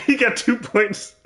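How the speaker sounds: mock the score while laughing; giggling at two points. Real, not acted.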